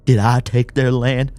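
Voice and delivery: high, loud, distressed pleading; panicked apology. Acted.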